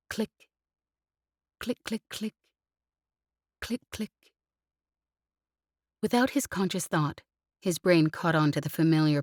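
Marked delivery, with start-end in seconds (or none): 0.1-4.1 soft clicking sound. Acted. Comm clicking in pilot's alphabet.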